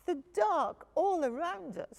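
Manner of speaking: high, small frightened cub voice; Little Bear. A narrator's reading. Little cub telling dad he's scared. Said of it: "said Little Bear".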